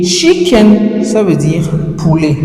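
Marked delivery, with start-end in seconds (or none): 0.0-1.0 emphatic contrast. Shown as a demonstration. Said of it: "Kitchen, ça veut dire cuisine".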